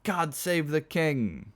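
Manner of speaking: slow, high proclamation; ceremonial toast. Acted. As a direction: proclaim it with long vowels. Toasts from drunken chorus.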